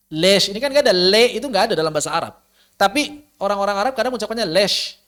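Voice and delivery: ordinary talk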